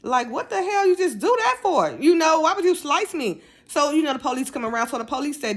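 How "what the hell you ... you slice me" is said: half-hearted stunned disbelief; shocked outrage. Retold or imitated. To friend who just cut her with a knife, higher shocked outrage. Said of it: "I'm just thrown off".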